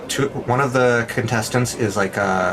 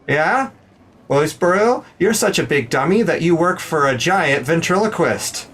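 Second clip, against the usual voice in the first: higher, cocky jab; roast comeback; 7 semitones higher than usual. Acted. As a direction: land the insult. Dorgo delivers roast line.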